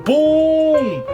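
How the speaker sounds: normal talking voice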